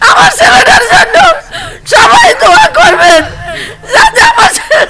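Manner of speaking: high wailing sobbing plea; crying out high in grief; hit hard crying out. Real, not acted. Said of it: "কাইন্দো না তুমি".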